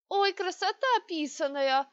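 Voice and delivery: high, squeaky, slow mock woman's voice; a man faking a squeaky old woman; squeaky falsetto. A narrator's reading. Dinar in disguise mimics the matron, squeaky falsetto. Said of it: "продолжил Динар писклявым голосом".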